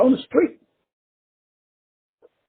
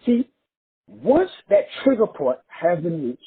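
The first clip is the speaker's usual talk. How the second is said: ordinary talk, nothing marked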